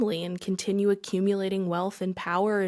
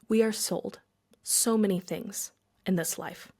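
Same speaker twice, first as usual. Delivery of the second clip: normal talking voice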